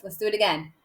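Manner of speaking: normal talking voice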